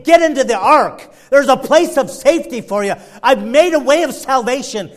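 God's urgent call. Acted. Preacher voicing God's call.

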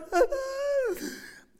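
very high, loud falsetto wail; mock wail. Delilah wailing at Samson, hammed up.

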